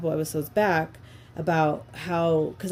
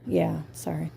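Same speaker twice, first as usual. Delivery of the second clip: whispered apology. Real, not acted. Podcaster whispering sorry to her partner after a joke.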